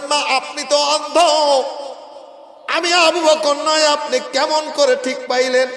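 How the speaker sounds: high, loud chanted question; calling out. Acted. Caliph asks the blind old woman, calling out high. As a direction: call it out at sermon pitch. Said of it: "ডাক দিয়া বলতেছেন".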